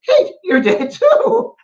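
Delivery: high, slow Scottish voice, gently amused; sister's reply. Retold or imitated. Sister Mary answering Kate, slow high Scottish voice. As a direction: draw it out like the sister. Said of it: "my sister Mary".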